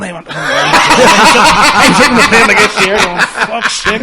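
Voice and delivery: laughing hard while telling it; real laughter at the memory. Real, not acted. Laughing retelling plane moment.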